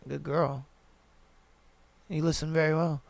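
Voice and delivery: low, soft, stern praise. Shown as a demonstration. Coaching fellas how to praise her, low and stern. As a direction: drop the voice down low and quiet. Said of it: "slow the speech down, lower the voice a little bit".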